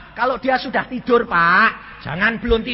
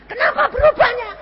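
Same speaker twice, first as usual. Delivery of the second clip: fast, high, loud squeal; astonished question; 5 dB louder, faster than usual. Retold or imitated. Husband asking wife what changed, astonished squeal. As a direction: demand it in hammed-up amazement.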